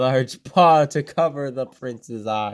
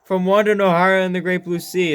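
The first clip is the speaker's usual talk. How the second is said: excited recognition; 6 semitones higher, 6 dB louder, faster than usual. Real, not acted.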